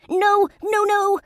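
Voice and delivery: very high, loud panicked cries. A narrator's reading. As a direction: shriek as the potion comes closer. Fairy panics at potion.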